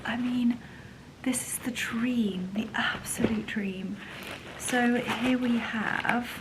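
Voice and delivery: hushed ASMR-style whisper, slow in places. Real, not acted. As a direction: breathe the words over the treats. Whispering while unboxing.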